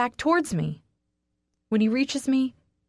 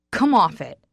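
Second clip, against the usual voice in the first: growled; 8 dB louder than usual. Acted. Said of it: "he growls".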